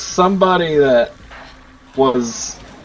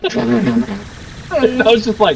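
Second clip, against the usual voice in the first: normal talking voice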